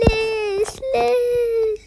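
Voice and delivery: tearful pleading, voice breaking; crying. Real, not acted. Girl begging to get her iPad back, crying. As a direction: plead through tears, fading to a whispered sob.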